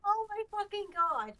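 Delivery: loud, disbelieving cry; exasperated outburst. Real, not acted. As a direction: blurt it out in disbelief. Hearing the next song spoiled.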